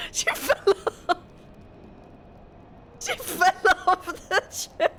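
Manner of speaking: real breathless laughing through the words; helpless laughter. Real, not acted. Owner tells how the cat fell off the chair, laughing. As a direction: squeal it out, breaking up laughing.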